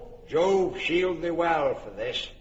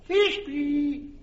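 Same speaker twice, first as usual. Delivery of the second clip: calling out; 8 semitones higher than usual. Acted. Pyramus calling out.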